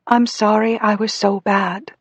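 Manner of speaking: put-on high, slow little-girl voice; sad childish apology. A narrator's reading. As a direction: apologise as a small, remorseful child. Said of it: "Her childish voice through the speaker sounded so sad".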